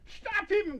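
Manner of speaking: urgent high-pitched call; alarmed cry. A narrator's reading. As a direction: cry out sharply, pitch leaping up. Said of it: "cried Missus Nibble".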